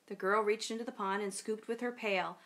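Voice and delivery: ordinary delivery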